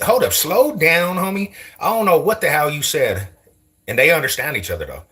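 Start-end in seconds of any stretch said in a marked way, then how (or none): 0.0-3.3 confused. Pretend. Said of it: "Like, what?".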